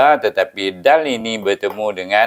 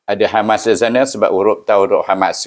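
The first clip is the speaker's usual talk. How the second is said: ordinary talk, nothing marked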